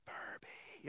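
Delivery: breathy whispered "Furby"; whispered longing. Retold or imitated. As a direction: whisper it like a secret craving. Covetous whisper at Walmart.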